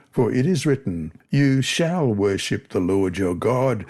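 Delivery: usual speaking voice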